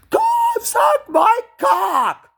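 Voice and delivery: very high, slow, loud mock scream; drawn-out shrieked provocation; pretend shout. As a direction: hurl it across Times Square. Imagined yelling in Times Square.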